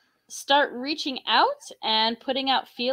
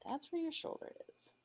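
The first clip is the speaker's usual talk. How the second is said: whispering; 6 semitones higher, 29 dB quieter than usual. Real, not acted.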